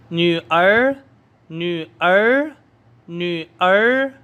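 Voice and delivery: न्यूअर with a rising second tone, drawn out. Shown as a demonstration. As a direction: rise steadily through न्यूअर. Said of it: "आपने second tone के साथ पढ़ना है".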